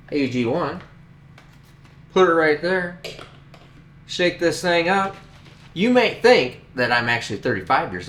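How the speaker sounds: upbeat scripted ad read, lightly performed; friendly commercial voice; sponsor ad read. Acted. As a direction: sell the product like a spokesman, breezy and rehearsed.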